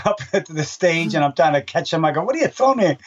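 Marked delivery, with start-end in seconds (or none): 2.2-3.0 mock alarmed surprise. Fan throws bars at him.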